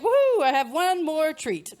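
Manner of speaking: drawn-out, celebratory whoop of relief; gleeful whoop. Acted. Comic imitating women home at night, bra off, gleeful whoop. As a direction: let out a loud, slow woohoo, hamming it up.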